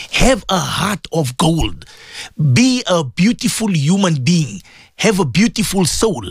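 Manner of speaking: slow down and plead gently; sincere exhortation. Real, not acted.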